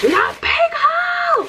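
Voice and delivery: high drawn-out squeal; squealing high and loud; excited squeal. Real, not acted. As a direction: shriek it, way up and slow.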